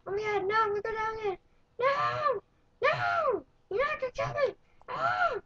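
high, loud terrified cries of no; frantic shrieks while being chased; panicked screaming. Real, not acted. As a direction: scream in real panic as it closes in. Panicking as a crawler attacks.

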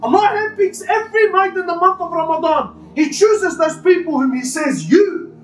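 high, urgent sermon voice with rising passion; impassioned preaching. Acted. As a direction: preach it with full force to the congregation. Continues preaching Ramadan mercy.

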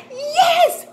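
very high, loud "yes"; excited cheer. Pretend. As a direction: cheer the children's right answer. Teacher cheers a right answer.

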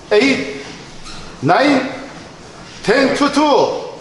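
drawn-out count. Real, not acted. Counting out the last reps.

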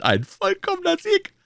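very high-pitched jubilant king; beaming royal exclamation. A narrator's reading. King celebrating his musketeers' victory, beaming high-voiced cry. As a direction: exult in a high head voice. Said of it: "rief der König ganz strahlend".

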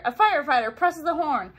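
ordinary delivery

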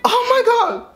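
high, nervy outburst; rattled exclamation. Real, not acted. Prank caller still shaking after the call, rattled.

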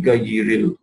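gayril said slowly with a plain g; slow wrong gayril; wrong hard g. Shown as a demonstration. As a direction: demo the mistake, gayril with g. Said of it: "That is incorrect".

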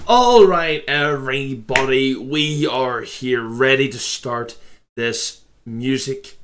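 loud, slow, hyped intro to the video; booming YouTuber welcome. Real, not acted. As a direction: kick off the reaction big and loud.

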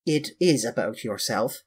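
usual speaking voice

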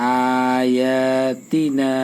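আয়াতিনা drawn out slowly on first, second and last vowels, তা quick; madd lengthening. Shown as a demonstration. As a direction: stretch আ, য়া and না but clip তা in আয়াতিনা. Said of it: "এটা একটু তার চেয়ে তাড়াতাড়ি পড়তে হবে". (আয়াতিনা টেনে, তা তাড়াতাড়ি)

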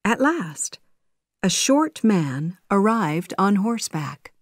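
ordinary delivery, nothing marked

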